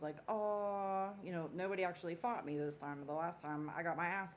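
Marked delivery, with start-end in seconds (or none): 0.3-1.1 higher, louder mock "aw"; disappointed groan. Party crowd told to leave, mock disappointment. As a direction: imitate a let-down crowd.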